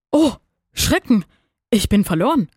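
acted dismay in broken phrases; horrified. A narrator's reading. Earthworm spots the hungry rooster, horrified. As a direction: gasp it out piece by piece, aghast. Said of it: "murmelte er entsetzt".

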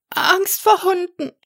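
hohe, helle Drachenstimme, ängstlich; kleiner Drache. A narrator's reading. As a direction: spricht als kleiner, verzagter Drache. (hohe Stimme des kleinen Drachen, der Max seine Angst gesteht)

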